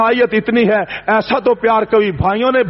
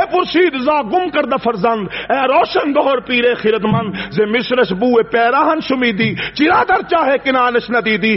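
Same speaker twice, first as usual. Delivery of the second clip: slow, lifted recitation of the Persian couplet; poem recital; 3 semitones higher than usual. Acted. Preacher quoting Sheikh Saadi to the congregation, slow poetic recital. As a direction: intone the verse with reverence.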